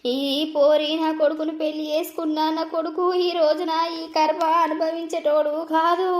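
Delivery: usual speaking voice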